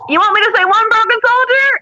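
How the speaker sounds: loud, high-pitched sarcastic call; cheeky mock yell. Injured recruit holds up her cast to sergeant, cheeky yelling.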